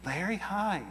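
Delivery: [name]. Retold or imitated. Singer calling someone asleep in bed, imitated high-pitched. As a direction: do the late-night caller, pitched well up. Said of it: "Michael would call up and he'd go".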